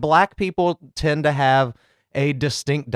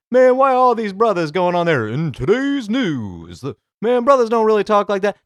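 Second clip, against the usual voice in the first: higher-pitched, louder hammed-up comic bit; mock comedian ranting in exaggerated swoops; hack stand-up comic; 7 semitones higher, 4 dB louder than usual. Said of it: "like, B E T comic".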